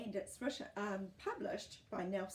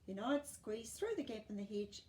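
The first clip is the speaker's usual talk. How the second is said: usual speaking voice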